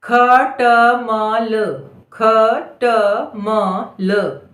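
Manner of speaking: very slow dictation; खटमल drawn out as ख, ट, म, ल; syllable by syllable. Shown as a demonstration. Dictating word sound by sound.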